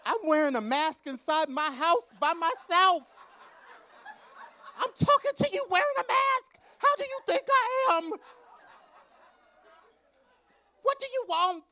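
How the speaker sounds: high, loud, laughing tirade; shrieking rant. Retold or imitated. Author screaming at her publisher about the pandemic, shrieked and laughing. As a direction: shriek it out in disbelief. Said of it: "And I was like".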